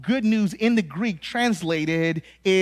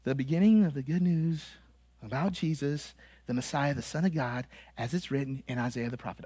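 soft, low, underplayed Bible reading; dull home reading; 8 semitones lower, 10 dB quieter, faster than usual. Shown as a demonstration. Preacher imitating listeners' home Bible study, soft listless reading. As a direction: mumble through the verse with no emphasis. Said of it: "you probably read it like this".

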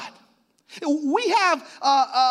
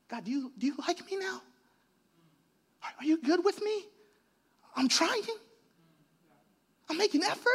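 very soft, higher, tentative questions; timid pleading; 6 semitones higher, 9 dB quieter, faster than usual. Acted. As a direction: ask God meekly, barely daring to speak. Timid plea to God with note.

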